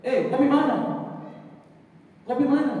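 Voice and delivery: high, loud clipped Papuan speech; clipped Papuan-accented question. Retold or imitated. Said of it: "Kayak orang Papua".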